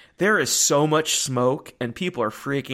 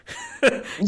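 breathy laugh; 10 semitones higher than usual. Real, not acted. Reaction to wrong hotel.

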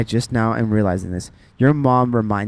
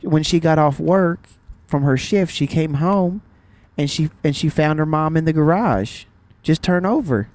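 Southern mom telling it, pitched up; Southern accent; 3 semitones higher than usual. Acted. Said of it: "saying this in a Southern accent".

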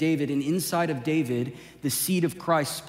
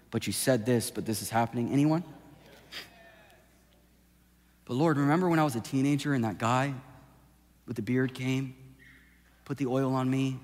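softer, lower, intimate preaching; soft sermon over music; 5 semitones lower, 5 dB quieter than usual. Acted. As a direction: bring it down gently as the band plays.

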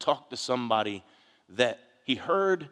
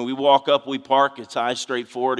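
mock macho bravado; 10 dB louder than usual. Mock macho guy behavior.

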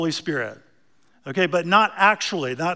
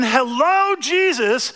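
higher, mildly raised imitation of a kid calling; kid's mock yell; 6 semitones higher, 3 dB louder, slower than usual. Preacher re-voices the child's greeting to make his point, mock yelling out. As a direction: echo the kid's greeting, half-yelled. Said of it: "the little kid in front of Padraig yells out".

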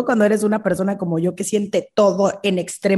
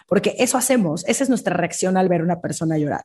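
ordinary delivery

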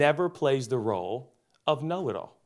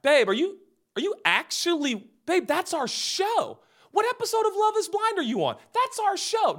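whiny jealous husband. Retold or imitated. Husband whining to wife about their show, high and pleading. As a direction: whine like a wounded husband. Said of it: "I'd walk in and be like".

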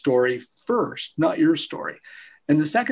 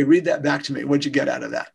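ordinary delivery

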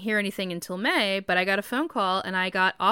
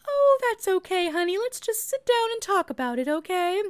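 much higher, sugary diva-puppet voice; Doctor Ogg, the voice teacher, comforting her struggling student in a high puppet-diva voice; Doctor Ogg like Miss Piggy; 10 semitones higher than usual. Retold or imitated. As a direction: coo it like a fussy puppet diva soothing a student. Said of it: "She talked like Miss Piggy".

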